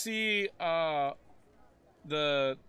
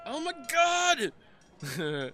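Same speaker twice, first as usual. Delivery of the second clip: high, slow gasp of shock; 7 semitones higher, much slower than usual. Acted. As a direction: stretch out a stunned oh my god. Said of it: "and then he's like".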